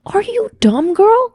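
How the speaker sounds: high-pitched, rising disbelief; mock incredulous scolding. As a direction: act out baffled sass, climbing sharply on "girl". Acted scolding of her naivety.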